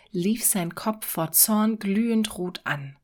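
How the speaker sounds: usual speaking voice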